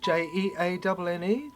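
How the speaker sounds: normal talking voice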